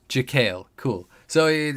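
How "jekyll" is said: Jekyll said as "juh-KALE"; confirmed pronunciation. Shown as a demonstration. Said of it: "it's pronounced like my name".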